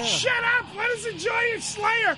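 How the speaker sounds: high-pitched mock fan outburst; mock shouting. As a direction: shout over the music in mock protest. Mock outburst over the music playing.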